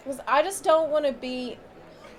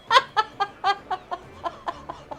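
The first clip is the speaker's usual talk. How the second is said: long high-pitched laugh; 7 semitones higher than usual. Real, not acted. Streamer amused that the American was probably wrong, laughing. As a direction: peals of high laughter dying away.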